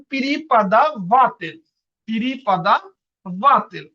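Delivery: প্রিপাদা ভাতেল slowly, split before "vatel"; slow pronunciation model. Shown as a demonstration. Teacher asking everyone to repeat, প্রিপাদা ভাতেল slow and broken into parts. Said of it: "এটার উচ্চারণ কি হবে".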